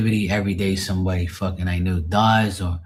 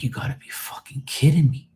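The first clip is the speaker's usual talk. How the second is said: hushed, stunned whisper; whispered disbelief; 8 semitones higher than usual. Retold or imitated. To his friend who took his life, a stunned whisper. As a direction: whisper it like you can't believe it. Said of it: "I'm like".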